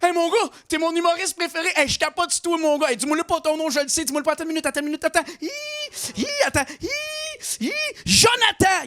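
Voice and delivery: high, eager fan voice; excited fan. Retold or imitated. Fan stopping the comedian on the street, high excited put-on voice. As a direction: gush like a fan trying to guess a name. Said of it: "il y a un gars, il m'arrête, il me dit".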